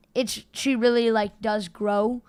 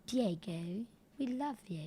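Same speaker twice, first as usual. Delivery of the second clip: soft, quiet fake British accent; gently hammed-up British accent; 10 dB quieter than usual. Shown as a demonstration. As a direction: put on a hushed, bogus British accent. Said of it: "a fake British accent".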